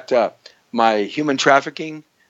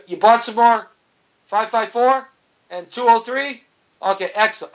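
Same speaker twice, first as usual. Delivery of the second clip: higher-pitched mock urgent check; pretend phone call; 8 semitones higher than usual. Host pretends to phone about survival supplies, raised voice.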